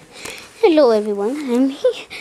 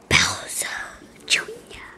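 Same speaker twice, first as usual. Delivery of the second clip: menacing whisper; 10 semitones lower, 14 dB quieter than usual. Pretend. Villain whispers threat in play.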